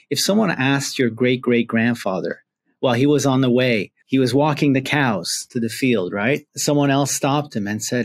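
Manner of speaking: ordinary delivery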